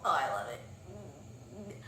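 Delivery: soft, slow, swooning; dreamy sigh. Real, not acted. Sighing to viewers about loving the book. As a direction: melt into it.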